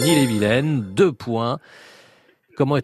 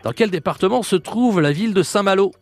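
game show host; 8 semitones higher than usual. Acted. Quiz host question.

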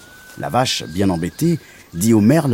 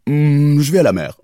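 raised, loud cow voice with a long Hmm; vache de conte; 6 semitones higher, 6 dB louder than usual. Acted. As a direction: moo into the answer. La vache répond au lapin. (vache qui meugle sa réponse)

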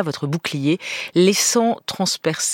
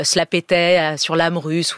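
ordinary delivery

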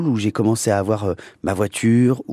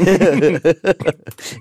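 real laughter breaking out; giggling at the joke; high laughter; 7 semitones higher, 5 dB louder than usual. Real, not acted. Guest laughs at question.

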